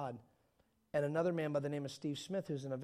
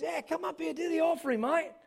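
high-pitched mock Australian evangelist; Australian accent; 11 semitones higher, 4 dB louder than usual. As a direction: call him up in a put-on, high Australian accent. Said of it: "that's my Australian accent".

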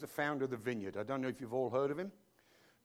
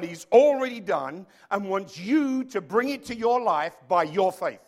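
louder, driving sermon cadence; preaching at full force; 7 dB louder than usual. Acted. Pastor urging the church to claim it by faith, full-force preaching. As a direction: hammer each line home to the congregation.

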